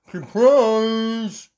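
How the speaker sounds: loud, muffled, drawn-out word; muffled mumble. Acted. Daddy Pig surprising Mummy, mumbling loudly. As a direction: push it out through a mumble. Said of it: "mumbled Daddy Pig".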